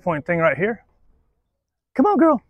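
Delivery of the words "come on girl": high, short call to a dog; high-pitched encouragement; coaxing the dog. Real, not acted. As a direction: coax the dog in a lifted voice.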